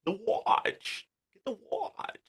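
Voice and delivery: breathy, whispered Walken-ish line; a hushed, half-whispered movie quote. Pretend. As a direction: whisper the line like a film villain. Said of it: "Got any more?".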